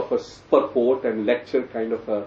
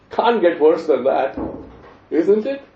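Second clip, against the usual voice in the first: loud sarcastic punchline; mock-appalled verdict; 4 semitones higher, 4 dB louder than usual. Real, not acted. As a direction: land it as emphatic irony. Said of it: "Sarcastically speaking".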